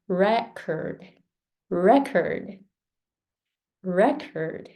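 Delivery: "record" repeated for practice, REC strong each time; stress on REC. Shown as a demonstration. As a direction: drill "record" with first-syllable stress. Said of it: "Re is stronger than".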